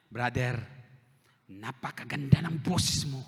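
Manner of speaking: slow, sugary compliment; a slow, honeyed voice of praise; sweet mock praise. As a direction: flatter him gently and slowly. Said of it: "Gumaganon pa".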